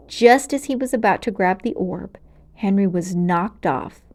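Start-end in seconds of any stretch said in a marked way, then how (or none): none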